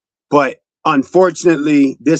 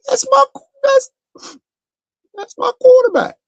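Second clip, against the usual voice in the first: much higher, emotional cry of praise; Terrell Owens impression. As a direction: gush it like a star receiver close to tears. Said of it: "In my Terrell Owen voice".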